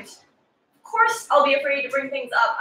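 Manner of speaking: normal talking voice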